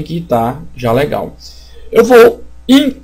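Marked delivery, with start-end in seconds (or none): 1.9-3.0 broken off by a hiccup. Real, not acted. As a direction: hiccup cuts the word. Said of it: "tô com soluço".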